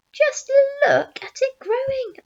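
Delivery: high, loud cry of amazement; excited cry. A narrator's reading. Aunt watching the fruit grow, high excited cry. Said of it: "Aunt Spiker cried".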